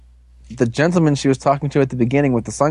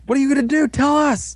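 demanding villain; 11 semitones higher than usual. Retold or imitated. Villain demanding in retold scene.

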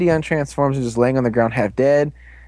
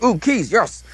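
excited monkey; 6 semitones higher, slower than usual. Retold or imitated. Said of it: "the monkey's like".

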